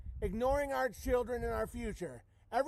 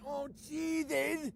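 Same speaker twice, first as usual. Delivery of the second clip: slow mock moan; 6 semitones higher, much slower than usual.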